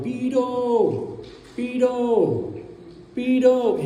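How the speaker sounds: high, sung-out street call, falling at the end; imitated seller's yell. Seller out on the street hawking wardrobes, imitated yell. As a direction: mimic a hawker's long, high cry. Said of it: "the seller was yelling".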